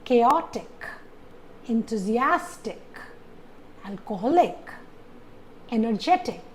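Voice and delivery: chaotic on O, enthusiastic on AS, alcoholic on HO, energetic on GE; second-last syllable stressed. Shown as a demonstration. Teacher lists I C words, stressing chaotic, enthusiastic, alcoholic, energetic second from last. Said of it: "falls on the second last syllable".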